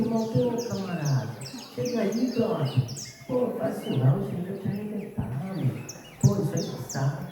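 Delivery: soft, easygoing buddy voice; meek friend impression. Preacher mimics his mild friend talking someone out of it, meekly. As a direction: gently coax like an overly mild pal. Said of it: "um amigo que ele é manso demais".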